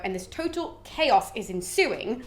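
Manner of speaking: plain reading voice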